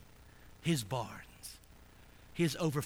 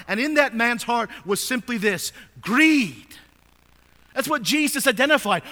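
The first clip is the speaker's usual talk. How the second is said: loud, ringing pulpit voice, pitched higher; full-force preaching; 8 semitones higher, 13 dB louder than usual. Acted. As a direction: preach it out to the whole congregation. Preacher driving home the warning.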